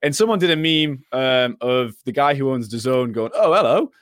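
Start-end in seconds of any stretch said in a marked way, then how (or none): 3.4-3.9 mock London accent, raised and louder. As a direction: put on a cheeky London accent for a quick greeting. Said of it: "in, like, his London accent".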